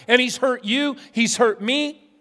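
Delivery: normal talking voice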